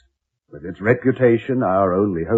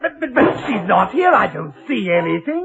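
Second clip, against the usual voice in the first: bewildered; 8 semitones higher than usual. Acted. Husband bewildered during haunting.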